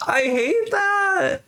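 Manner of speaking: high, slow whiny complaint; mock whine. Streamer whining to a viewer about her Sims' breakup. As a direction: whine it like a sulky kid.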